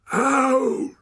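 loud, high bellowed order. A narrator's reading. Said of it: "bellowed a voice behind him".

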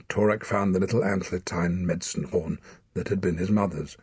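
ordinary delivery

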